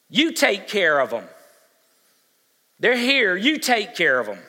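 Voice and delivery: Jesus insisting, you feed them; emphatic you. Retold or imitated.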